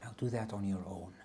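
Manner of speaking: calm, soft meditation guide voice; guided meditation. Acted. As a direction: ease them into a body scan. Leads a grounding meditation.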